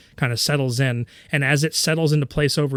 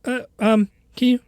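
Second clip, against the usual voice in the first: high, stuttering, panicked apology; stammering frightened boy; 12 semitones higher, 4 dB louder than usual. Acted. Scared boy waking up, apologizing in a high stammer. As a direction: stammer it out in a rush of fear. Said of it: "he's like".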